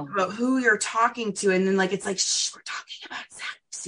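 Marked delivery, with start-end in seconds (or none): 2.2-3.9 hushed whisper with a shushing start; mock secretive whisper. Guest mimics secrecy around talking about sex, whispering.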